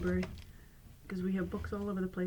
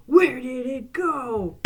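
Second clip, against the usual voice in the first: loud gasp then slow, louder "Where did it go?"; mock gasp; 4 semitones higher, 9 dB louder, much slower than usual. Magician faking surprise for the audience, loud and slow. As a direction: ham up the shock. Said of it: "and you go".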